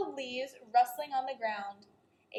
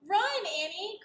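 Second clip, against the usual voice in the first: cried out; 8 semitones higher than usual. A narrator's reading. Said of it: "cried Jack".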